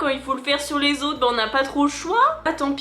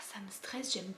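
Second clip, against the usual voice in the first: whisper; 4 semitones lower, 17 dB quieter than usual. Real, not acted. Stressed during self-inserted tube.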